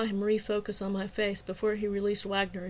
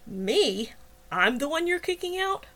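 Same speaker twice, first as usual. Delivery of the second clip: sneering; 6 semitones higher than usual. A narrator's reading. Wagner being thrown out by Jenny, sneering in disbelief. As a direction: sneer in disbelief, then drop for the last word. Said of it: "Wagner sneered".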